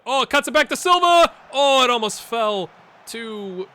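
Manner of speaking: sudden high, loud commentary burst; excited chance call. Real, not acted. Gamer sees it cut back to Silva, high excited shout. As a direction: leap into a shout as it unfolds.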